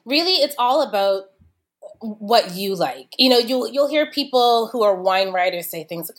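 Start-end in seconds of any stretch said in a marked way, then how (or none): none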